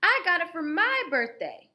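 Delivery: put-on high, loud childish lie; insistent yell. Ruthie lying loudly.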